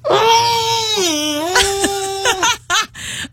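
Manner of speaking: operatic scream into a shirt. Acted. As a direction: belt a high opera scream, muffled. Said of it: "let me try to opera scream into my shirt here".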